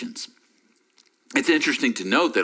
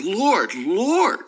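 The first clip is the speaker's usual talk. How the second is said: second Lord pitched up and louder; emphatic double call; 8 semitones higher, 4 dB louder than usual. Shown as a demonstration. Pastor demonstrating an urgent double "Lord" to listeners. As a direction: call "Lord, Lord" like getting attention. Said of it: "The repeating of that phrase is for emphasis".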